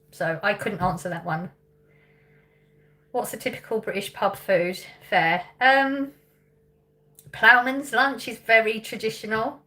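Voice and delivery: normal talking voice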